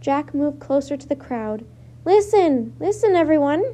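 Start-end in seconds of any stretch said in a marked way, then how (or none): 2.1-3.6 loud, higher call for attention; yelled. A narrator's reading. Boy yelling to the crowd over the waves, loud. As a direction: shout over the roaring waves. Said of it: "he yelled".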